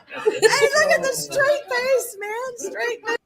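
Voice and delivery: high, laughing through the words. Real, not acted. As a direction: talk while cracking up. Laughing while watching herself.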